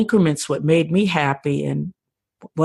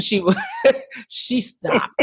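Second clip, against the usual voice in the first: normal talking voice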